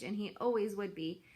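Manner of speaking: ordinary delivery, nothing marked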